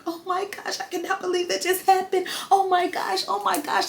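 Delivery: high-pitched, soft imitation of a tearful friend; mock crying. Susie after being beaten up, high mock-crying voice. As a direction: squeal it thinly in mock distress. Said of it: "Susie just started crying, just like".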